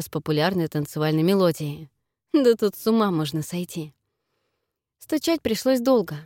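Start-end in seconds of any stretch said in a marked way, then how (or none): none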